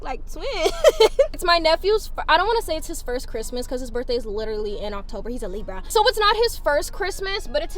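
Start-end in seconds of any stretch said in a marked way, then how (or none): 0.5-1.3 quick bright giggle; short laugh. Real, not acted. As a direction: let out a giggle. Laughs at the photo.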